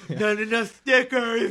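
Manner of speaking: high, slow, stretched-out imitation holler; hammed-up yell of a complaint; mock yelling. Imitating the booth guy refusing over stickers, mock yelling. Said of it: "like, yelling at him".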